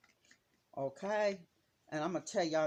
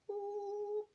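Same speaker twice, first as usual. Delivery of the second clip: tiny high-pitched boop as the paint drops; high squeaky boop. Real, not acted. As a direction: make a cute little high "boop" sound. Sound effect while painting.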